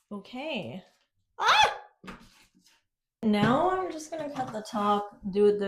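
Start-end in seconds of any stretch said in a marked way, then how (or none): 1.4-1.8 short high yelp of surprise; a sudden sharp cry as something slips; startled yelp. Real, not acted. Startled yelp while cutting wallpaper.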